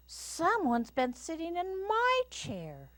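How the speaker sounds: very high mama bear voice. A narrator's reading. Mama Bear finds her chair used, high voice. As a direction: pitch it way up, airy and startled. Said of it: "said Mama Bear in her quiet voice".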